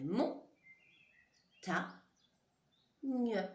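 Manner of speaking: mon, ta, gne, each set apart by a pause; syllable by syllable. Shown as a demonstration. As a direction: dictate "mon", "ta", "gne" one at a time. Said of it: "et la troisième syllabe".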